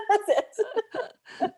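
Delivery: laughing through the words. Real, not acted. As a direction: finish the joke with a real laugh. Laughs at her own joke.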